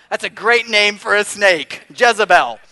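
preacher replying to the lady about her snake, loud and amused. Retold or imitated. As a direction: belt out the joke with glee. Retold reply after laughing.

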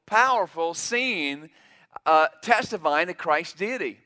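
ordinary delivery

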